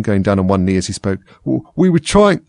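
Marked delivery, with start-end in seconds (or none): 1.4-2.4 high, faltering servile voice; humble stammer. A narrator's reading. Gardener explains himself to the Queen, humbly. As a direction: stumble into an excuse, humbly. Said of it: "in a very humble tone".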